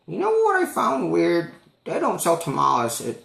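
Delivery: put-on old lady musing about tamales; high-pitched old woman impression. Old lady voiced in story.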